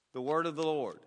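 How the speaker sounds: normal talking voice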